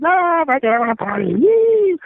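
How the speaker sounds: high, hollered imitation of a rowdy friend; mock party yell rising to a squeal; wild party guy. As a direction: whoop it up in a put-on high voice. Mocking friend's old party-loving self.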